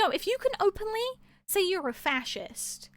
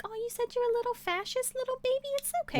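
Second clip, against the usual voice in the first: higher, softer, sing-song coddling; mock baby talk; 5 semitones higher, 7 dB quieter than usual. Mocking a co-host coddling a fascist in chat, in higher, soft baby talk. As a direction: coo at them like a pouting toddler. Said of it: "you'll just straight up just being like".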